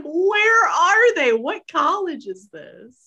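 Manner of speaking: high, slow awed gasp; wide-eyed wonder at grandeur; amazed. Retold or imitated. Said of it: "I was like".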